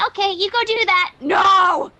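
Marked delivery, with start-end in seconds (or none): 1.2-1.9 loud protesting no; shout. Acted. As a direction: yell it out in protest. Sweetie protests.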